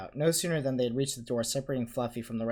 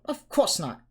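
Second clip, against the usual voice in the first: high, loud brisk assurance; 10 semitones higher, 7 dB louder, slower than usual. A narrator's reading. Hermione refusing to let Harry go alone, brisk. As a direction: dismiss the idea briskly. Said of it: "said Hermione briskly".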